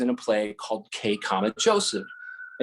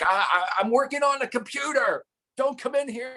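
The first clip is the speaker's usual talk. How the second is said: mock shout; 13 semitones higher than usual. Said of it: "I'll be like".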